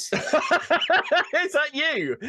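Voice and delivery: high, helpless laughter; laughing hard. Real, not acted. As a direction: squeeze the question out through laughter. Cracks up at Babe Station mention.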